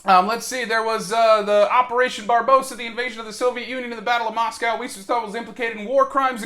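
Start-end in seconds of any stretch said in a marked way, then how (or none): none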